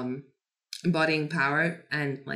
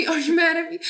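much higher, louder needy plea; whiny pleading; 12 semitones higher, 4 dB louder, faster than usual. Retold or imitated. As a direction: ask it like a scared puppy. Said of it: "He's like".

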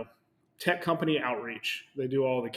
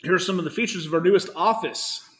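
corporate announcement voice; 4 semitones higher, 6 dB louder than usual. Acted. Reading the corporate post aloud.

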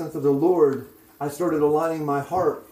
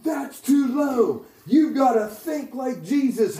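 high, drawn-out rebuke, then urging; insistent prophet; 8 semitones higher, slower than usual. Retold or imitated. Prophet hitting the speaker's back, insistent. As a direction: push him hard to think bigger. Prophet smacks him, demanding bigger faith.

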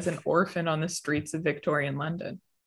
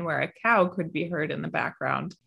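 normal talking voice

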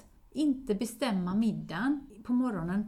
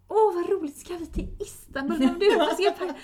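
much higher and louder, gushing; excited; 8 semitones higher, 9 dB louder than usual. Retold or imitated. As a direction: burst out with delight about the trip. Calls back, now on board. (ringer tillbaka till maken, högt och förtjust)

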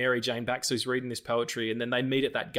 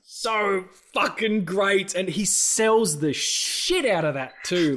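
higher, louder, slow and savoured; gushing excitement; 9 semitones higher, 6 dB louder, much slower than usual. Real, not acted. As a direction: rave about it, stretching every word. Gushing about the villain's performance.

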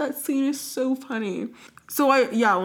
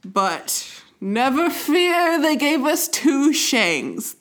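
dramatic announcer; 5 semitones higher, 4 dB louder, much slower than usual. Acted.

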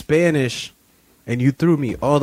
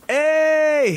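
excited. Real, not acted. Calls out triumphantly.

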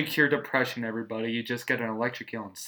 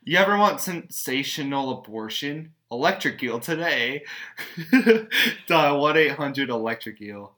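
mock infomercial; 2 semitones higher, 5 dB louder than usual. Mock ad for electric eels.

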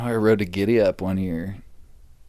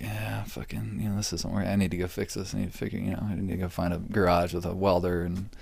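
softer, mumbled to himself; quiet inner thought; 3 semitones lower, 7 dB quieter than usual. Retold or imitated. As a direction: think it through under the breath.

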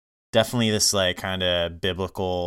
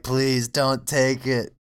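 mock pleading; 5 semitones higher, slower than usual. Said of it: "like, mad excited".

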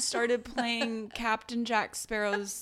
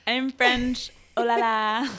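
loud hammed-up French accent; louder over-the-top French caricature; mock French; 4 semitones higher, 11 dB louder, slower than usual. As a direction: ham up a cartoon Frenchman. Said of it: "mocking French".

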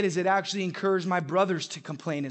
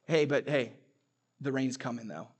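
gloomy warning; 5 semitones lower than usual. Acted. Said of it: "And he looks at you and he goes".

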